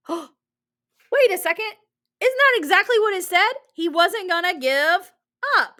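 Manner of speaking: gasp, then high, loud excitement; sudden realization. Pretend. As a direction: act as if the answer just hit you. Teacher acts out sudden discovery.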